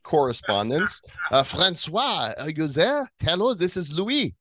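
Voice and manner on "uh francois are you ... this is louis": put-on high, lilting French accent; hammed-up French correspondent, pitched up. Host calls in a French correspondent, in a French accent.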